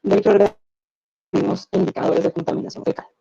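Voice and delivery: audio breaking up over a bad call; cutting in and out like a weak radio; choppy connection. Real, not acted. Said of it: "se escucha un poco in".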